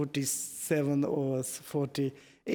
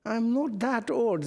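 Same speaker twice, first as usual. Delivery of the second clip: mock indignant; 8 semitones higher than usual. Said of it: "She said".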